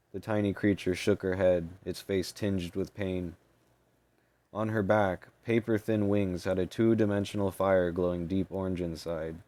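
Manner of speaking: ordinary delivery, nothing marked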